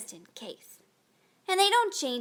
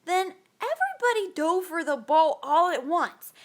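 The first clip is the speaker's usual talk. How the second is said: plain reading voice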